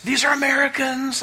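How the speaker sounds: excited announcement. Retold or imitated. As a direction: announce it like a proud son.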